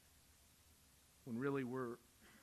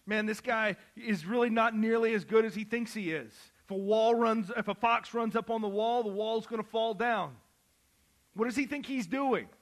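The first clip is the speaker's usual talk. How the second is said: loud, high scoffing paraphrase of the robbers; mocking robber; 7 semitones higher, 8 dB louder than usual. Preacher voicing the jeering robbers, loud and high. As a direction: sneer at him like a jeering criminal.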